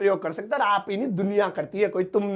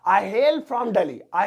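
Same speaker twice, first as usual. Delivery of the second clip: slow demo; much slower than usual. Shown as a demonstration. Teacher to students, modelling I hail from Delhi at a crawl. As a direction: say the new phrase very slowly. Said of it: "की जगह".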